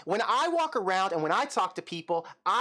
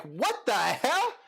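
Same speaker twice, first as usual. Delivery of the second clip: drawn-out, higher shocked "what the hell"; slow astonished outburst, pitched up; stunned disbelief; 6 semitones higher, much slower than usual. Retold or imitated. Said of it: "And it's like".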